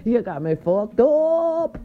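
loud, high, drawn-out exclamation; emphatic outburst. Real, not acted. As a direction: belt it out slowly, stretching the end. Boasting indignantly to viewers.

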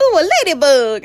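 mock delighted cooing. Staff spotting her tattoo while draping her, mock delight. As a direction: coo it like someone spotting something cute.

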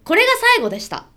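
high fast cheery quote; bright announcement. Retold or imitated. Quoting own announcement.

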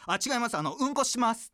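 high, loud retold announcement; 大声の宣言. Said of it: "でっかい". (大きな声で慌てて宣言)

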